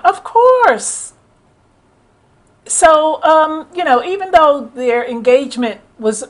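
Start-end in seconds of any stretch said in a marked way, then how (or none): 0.0-1.1 high sarcastic "of course"; knowing sarcastic jab, pitched way up. Real, not acted. Mocking the marriage, a high sarcastic jab.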